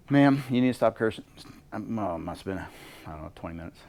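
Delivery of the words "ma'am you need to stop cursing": loud, firm phone warning; stern warning. Retold or imitated. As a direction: lay down the law, loudly. Telling off a cursing caller.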